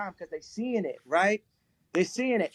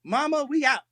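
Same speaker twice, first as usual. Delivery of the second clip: higher, lightly voiced wife; a hint of the wife's voice, pitched up; imitated wife; 6 semitones higher than usual. Husband retelling his wife quitting on him, lightly imitated wife. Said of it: "She said".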